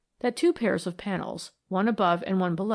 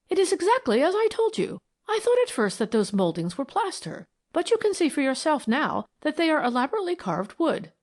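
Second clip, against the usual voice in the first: pleased; 7 semitones higher than usual. A narrator's reading. Proud of being right.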